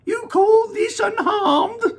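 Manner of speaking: high, slow, indignant shout; the mother snaps at Wonka over her shrunken son; snapped. Acted. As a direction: snap it back at him in outrage. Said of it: "snapped Missus T V".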